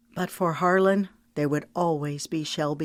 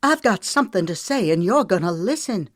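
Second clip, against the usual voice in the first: higher, louder demand; fevered insistence; 3 semitones higher, 5 dB louder than usual. Acted. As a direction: force him to hear you. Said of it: "Her voice rose to a fevered pitch".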